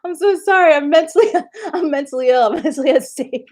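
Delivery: high, laughing apology; groveling apology. Retold or imitated. Apologizing to the actress on Twitter, high laughing grovel. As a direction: grovel to her through laughter. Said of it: "I was like".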